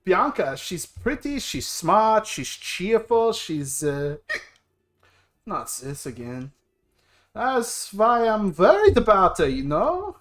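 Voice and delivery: put-on worried big brother with a German accent. Brother worrying over his sister, in a German accent. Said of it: "Why am I German?".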